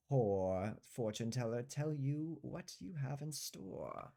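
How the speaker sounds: put-on high, slow old fortune teller voice. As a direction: croon it like an old seer. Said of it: "and says".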